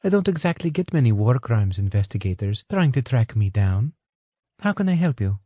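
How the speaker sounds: a hint of a polite English woman; Anna. A narrator's reading. Anna explaining to Johnson, lightly voiced.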